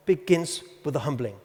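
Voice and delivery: ordinary delivery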